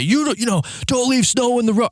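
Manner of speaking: high-pitched mock screaming; retold screaming. Man screams at plow driver, high imitated yell. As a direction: imitate him screaming, voice way up but not full volume. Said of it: "just starts screaming at me".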